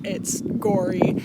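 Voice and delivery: ordinary talk, nothing marked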